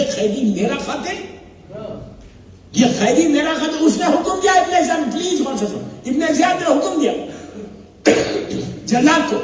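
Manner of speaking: fiery sermon storytelling at full force; impassioned majlis narration. Acted. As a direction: sweep the hall up in the drama, declaiming. Preaching the Karbala story.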